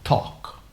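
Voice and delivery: "talk" with a silent L, said as tawk; teacher modeling "talk" without the L; model pronunciation. Shown as a demonstration. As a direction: drop the L in "talk", breathy and soft. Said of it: "You pronounce".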